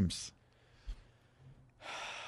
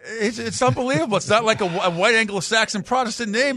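exasperated; 9 semitones higher, 6 dB louder than usual. Real, not acted. Outraged over a normal name.

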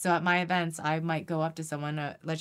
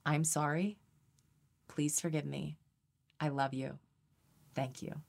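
soft prayer; 2 semitones lower, 5 dB quieter than usual. Shown as a demonstration. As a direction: offer each line gently to the divine. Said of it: "It's four simple sentences".

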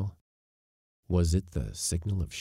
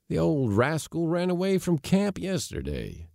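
lifted, ready reply; ready answer; 10 semitones higher than usual. A narrator's reading. Givens explaining the pet to Josefa, readily. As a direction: pitch it up, answering without hesitation. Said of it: "answered Givens readily".

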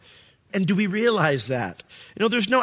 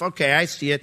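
normal talking voice

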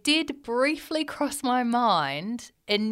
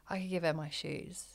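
quiet thought; 5 semitones lower, 14 dB quieter than usual. Retold or imitated. A brief second thought.

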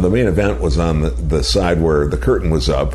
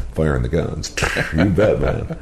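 ordinary delivery

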